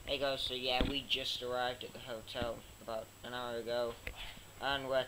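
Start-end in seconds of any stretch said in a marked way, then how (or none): none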